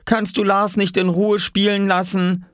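high, sustained reproach; scolding mother. Acted. Exhausted mother tells older boy off, reproachful scolding. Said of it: "schimpfte sie".

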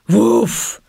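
sharp angry bear woof. Acted. Angry bear woofs at the boy. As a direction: bark it out like a bear.

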